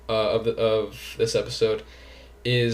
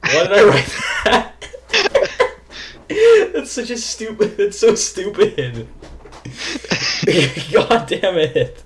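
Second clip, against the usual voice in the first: laughing; 8 semitones higher, 5 dB louder than usual. Real, not acted. Embarrassed laughing at own question.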